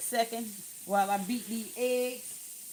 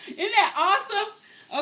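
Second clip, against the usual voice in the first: higher and louder with a laugh; laughing excitement; 8 semitones higher, 8 dB louder than usual. Real, not acted. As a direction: laugh with pride. Showing off the soaked chicken.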